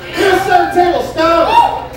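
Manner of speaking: ordinary talk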